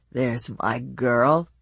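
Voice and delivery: put-on slow, slurred drunk husband; drunk slurring. A narrator's reading. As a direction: slur it like a tipsy man. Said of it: "his voice slurred".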